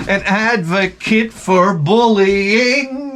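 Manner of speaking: high, drawn-out hammed-up proclamation; mock rallying cry. Comic pitches bullying's comeback to America, mock rallying cry. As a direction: holler it slowly like a mock public campaign.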